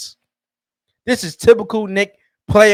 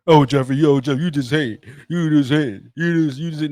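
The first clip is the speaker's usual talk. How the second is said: low, dismissive fan voice; lowered mock fan whining back; mock Knicks fan; 6 semitones lower than usual. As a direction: play the fan brushing him off, pitched low. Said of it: "Y'all ain't wanna listen to me".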